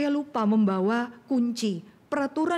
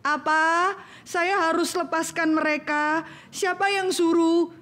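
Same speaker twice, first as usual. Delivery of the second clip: defiant Pharaoh; 6 semitones higher, slower than usual. Acted. Pharaoh defying God's command.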